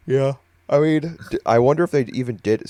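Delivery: words stretched by a yawn; talking through a yawn. Real, not acted. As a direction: speak mid-yawn. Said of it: "Sorry, I keep yawning".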